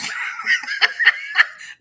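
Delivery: high breathy burst of laughter. Real, not acted. Host laughing at her own hypothetical, high breathy laugh. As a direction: crack up at the scenario.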